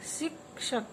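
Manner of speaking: शिक्षक said softly with clear क्ष; quiet teacher model of शिक्षक; soft model pronunciation. Shown as a demonstration. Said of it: "बोलिए मेरे साथ".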